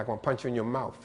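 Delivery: "mouth" said mouf with an f; quick, end "mouth" on an f. Shown as a demonstration. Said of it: "You'll hear it like an F".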